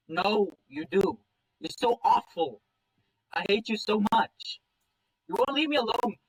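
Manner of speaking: high, loud, anguished outburst; acted meltdown near blubbering; tearful yelling. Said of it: "swallow back into a blubbering cry".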